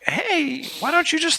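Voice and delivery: cartoon bird voice. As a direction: cheer like a tiny Looney Tunes bird. Voicing the birds' philosophy.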